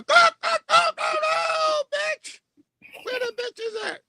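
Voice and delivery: very high squawking mock chicken; chicken clucking voice. Mocking a singer as a chicken, very high squawking clucks. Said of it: "you sound like a chicken".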